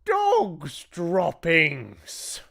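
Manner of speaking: high, drawn-out outcry; crying out in chorus. A narrator's reading. Witches cry out at a foul smell, high drawn-out cry. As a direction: cry it out slowly in high alarm. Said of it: "the others cried out".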